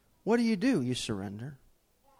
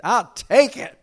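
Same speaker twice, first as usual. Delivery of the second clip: I'll take it, louder and higher; 6 semitones higher, 9 dB louder, slower than usual. Retold or imitated. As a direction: push I'll take it out gladly.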